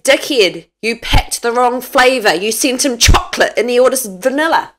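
loud but normal-pitch scold; her own way. Shown as a demonstration. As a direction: tell him off about the wrong flavour. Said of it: "I say".

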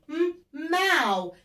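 drawing out ماو syllable by syllable very slowly; slow syllable sounding. Shown as a demonstration. Teacher sounding out ماو slowly for pupils. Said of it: "فبنقول م".

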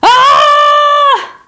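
high, loud shriek of fright; a sudden terrified bird scream; piercing alarmed yelp; startled scream. Acted. Pigeon meets huge dog.

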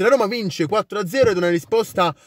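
normal talking voice

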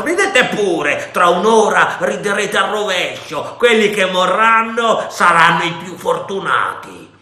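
high, loud, raging threats; pirate raging at the fort defenders; furious shouting. A narrator's reading. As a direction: spit each threat out in fury. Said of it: "gridò".

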